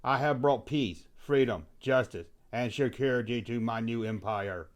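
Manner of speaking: softly spoken, solemn villain declaration; Anakin. Acted. Anakin answers the host about the Hutt, quiet and solemn. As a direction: proclaim it quietly and deliberately. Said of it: "Anakin, what do you think about".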